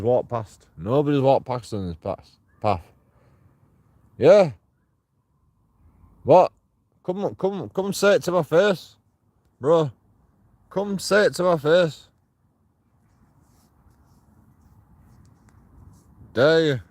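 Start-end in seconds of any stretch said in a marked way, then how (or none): 4.2-6.5 loud retort. Real, not acted. Answering shouts from the dark. 7.0-16.8 daring the voices in the dark to face him; taunting challenge. Real, not acted. Daring the hecklers to approach.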